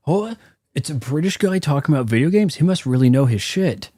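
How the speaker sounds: lightly put-on British voice, high start, a bit fast; mock British accent. Viewers fawning over a British gamer, mock British accent. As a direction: pose as a know-it-all British YouTuber. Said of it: "British voice, sounds like authority on YouTube".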